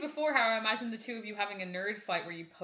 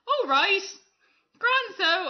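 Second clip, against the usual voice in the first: sister; 10 semitones higher, 8 dB louder, much slower than usual. Retold or imitated. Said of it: "she was like".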